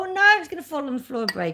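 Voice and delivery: quick, high mock panic; a touch of comic alarm; retold panic. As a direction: relive the moment it nearly fell. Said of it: "and I thought".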